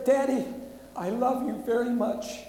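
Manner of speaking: loud and high, carried by the reading's full force; daughter's last words, read aloud. Acted. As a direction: ring it out as part of the urgent telling. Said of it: "she said".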